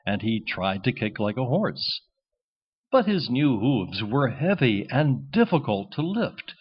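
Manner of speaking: usual speaking voice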